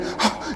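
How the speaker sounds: short excited gasp; acted breathless gasp; mock gasp. Disciples bursting in with news.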